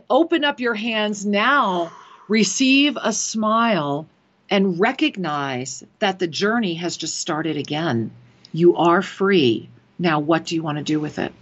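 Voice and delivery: low, slow, solemn voice of the angels; channelled spirit message. Acted. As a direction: deliver it slowly and deeply, like a voice from beyond. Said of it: "And they're saying".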